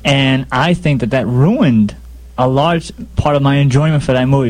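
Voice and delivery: ordinary talk, nothing marked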